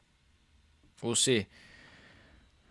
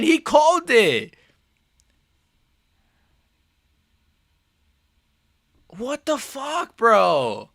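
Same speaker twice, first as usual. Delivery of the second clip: slow, drawn-out outraged disbelief, higher; indignant rant; 7 semitones higher, slower than usual. Real, not acted. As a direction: drag out the outrage. Still ranting about the stoppage.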